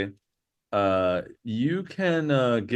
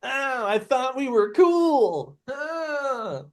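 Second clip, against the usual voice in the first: high, drawn-out wounded whine; hammed-up tearful complaint; hurt whine; 13 semitones higher, much slower than usual. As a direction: whimper it slowly, way up high. Wounded character whining at betrayal.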